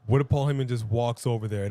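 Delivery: usual speaking voice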